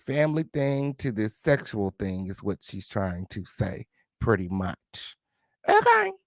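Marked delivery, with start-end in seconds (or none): 5.7-6.1 very high-pitched squeak; comic falsetto aside; squeaked reaction. Pretend. Sassy squeaked reaction to definition.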